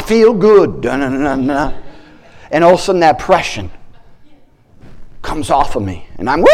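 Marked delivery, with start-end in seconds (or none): none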